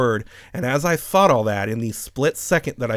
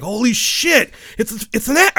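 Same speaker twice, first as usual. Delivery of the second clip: excited inner thought; 11 semitones higher, 6 dB louder than usual. Retold or imitated. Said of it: "My mind was like".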